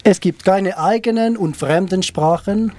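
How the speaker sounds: slow German reading in a rough Swiss accent; measured, Swiss-German-accented recital; approximate Swiss German accent. Shown as a demonstration. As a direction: read it slowly, attempting Swiss German pronunciation. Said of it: "accent suisse allemand approximatif".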